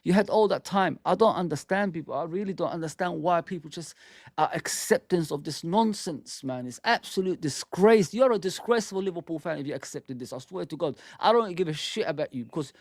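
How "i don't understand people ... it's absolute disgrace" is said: usual speaking voice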